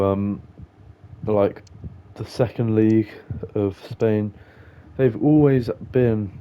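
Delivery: ordinary talk, nothing marked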